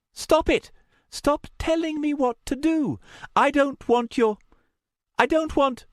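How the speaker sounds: high, loud and close to tears; high-pitched protest breaking off; upset, wobbling outburst. A narrator's reading. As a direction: cry out in angry distress. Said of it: "Luna's voice wobbled and she swallowed".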